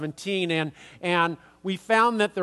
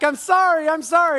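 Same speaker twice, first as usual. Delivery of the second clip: apologetic; 10 semitones higher, 6 dB louder than usual. Retold or imitated. Apologizing to the angry man.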